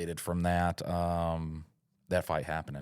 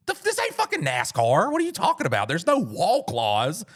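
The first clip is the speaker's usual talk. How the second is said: high, loud, mocking outrage; outraged disbelief; 9 semitones higher, 4 dB louder than usual. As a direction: squeal it like the rule is absurd. Outraged at the wall rule.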